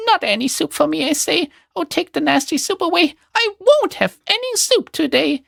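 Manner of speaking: put-on a sickly boy still squealing no soup; mild mock scream. A narrator's reading. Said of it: "he screams as loud as he is able".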